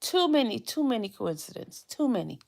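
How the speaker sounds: soft muttered repetition, fading; muttering to herself. Real, not acted. Muttering disbelief repeatedly.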